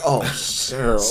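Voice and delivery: low, soft and let down; mock weary, dropped voice; disappointed sigh of words. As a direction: sink quietly into mild disappointment. [name] lets down gently, disappointed.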